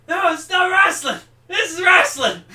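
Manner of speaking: high, loud furious yell; imitated angry shout. Retelling South Park, the wrestling teacher shouting high and loud in fury. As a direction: scream it like a frantic coach. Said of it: "and it's like".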